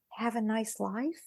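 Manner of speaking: dropped soft and slow, dry as a shrug; deadpan quiet. Retold or imitated. Trainer answering the angry engineer, soft deadpan quip. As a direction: let it land softly, teasingly blunt.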